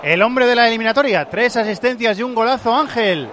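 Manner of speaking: excited. Real, not acted. Co-commentator hails the scorer.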